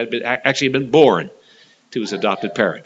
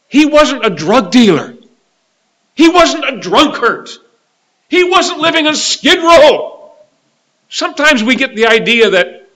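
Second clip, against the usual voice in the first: high, loud pulpit proclamation; impassioned preaching; 11 semitones higher, 7 dB louder than usual. Real, not acted. Preacher tells congregation of the rich man in Luke, impassioned. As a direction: ring it out to the congregation, insistent and forceful.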